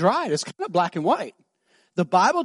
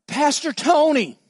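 impatient plea; 6 semitones higher, slower than usual. Retold or imitated. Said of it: "she goes".

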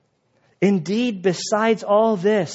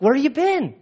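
disbelieving disciples; 9 semitones higher than usual. Retold or imitated.

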